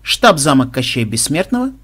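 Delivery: usual speaking voice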